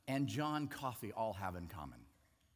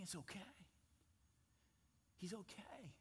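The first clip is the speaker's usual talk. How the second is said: hushed reassurance; 6 semitones higher, 18 dB quieter than usual. Retold or imitated. Jesus going to death, calm.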